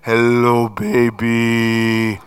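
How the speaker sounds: low, loud, drawn-out hello; deep booming baby greeting. Retold or imitated. Dad greeting his crying newborn, deep boom. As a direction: boom it slowly on a low note.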